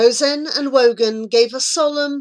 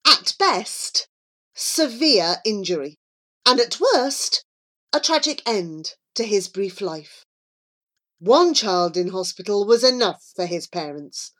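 ordinary delivery, nothing marked

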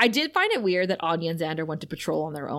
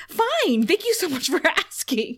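much higher, delighted mock Anya; pleased Anya; 7 semitones higher than usual. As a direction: play her flattered and beaming.

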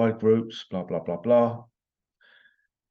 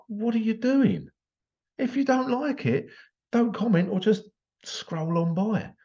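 much higher, incredulous mock retort; exasperated retort; 11 semitones higher than usual. Answering a Facebook troll, high exasperated retort. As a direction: fling it back, high and disbelieving. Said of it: "It's like".

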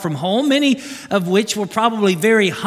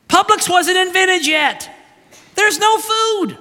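much higher, rising incredulous exclamations; excited; 8 semitones higher than usual. Real, not acted. Preacher picturing feeding twenty thousand, growing excited. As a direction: pile up the disbelief, pitching higher. Preacher building on the impossible logistics.

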